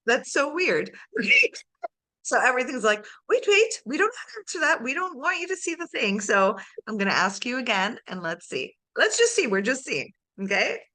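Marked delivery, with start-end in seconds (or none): 3.3-6.2 high, loud, hurried voice of the mind dodging; mock panicky inner voice. Coach mimics her client's mind, high and mock-alarmed. 9.0-9.5 high, loud, teasing sing-song; mock sing-song. Coach teases the client's dodging mind, sing-song. Coach mocking a reluctant inner voice.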